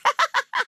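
high witchy cackle; mischievous cackle. Acted. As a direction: gloat with a high, quick laugh. Witch laughs at the player.

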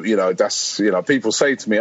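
normal talking voice

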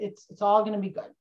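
ordinary talk

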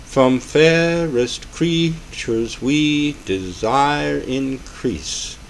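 slow, each syllable timed in strict meter; strict metered reading. Shown as a demonstration. Lecturer demonstrating overly strict iambic meter to students, slow and rigid. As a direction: read it like a metronome, too rigid. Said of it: "you're gonna run into trouble if you try to read poetry strictly that way".

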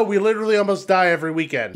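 ordinary delivery

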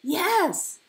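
high, loud, quick joy; happy. A narrator's reading. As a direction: burst out happily. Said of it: "he said happily".

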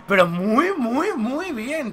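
slow, stretched muy muy; lingering emphatic praise; drawn-out praise. Real, not acted. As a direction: savour every word. Drawing out praise.

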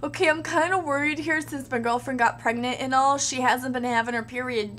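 usual speaking voice

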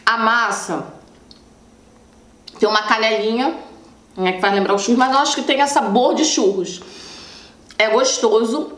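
chewing while talking; talking between bites; mouth full. Real, not acted. Seen 0: Bit pastry just before; jaw and lips show chewing while talking.

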